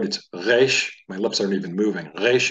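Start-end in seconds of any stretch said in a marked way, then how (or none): 0.3-0.9 "Reish" with the R from the back of the throat; throaty uvular R in "Reish", lips still; guttural R. Shown as a demonstration. Teacher demonstrating the guttural R, no lip movement. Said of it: "from the back of the throat". 2.2-2.5 "Reish" with the R from the back of the throat; throaty uvular R in "Reish", lips still; guttural R. Shown as a demonstration. Teacher demonstrating the guttural R, no lip movement. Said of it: "it's coming from the throat".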